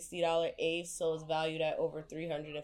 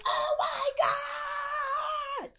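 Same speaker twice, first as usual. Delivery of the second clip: very high squealing oh my God; mock scream of the caller. Worker imitating the caller screaming after hanging up, very high squeal. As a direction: mimic her shriek of joy. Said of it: "She's like".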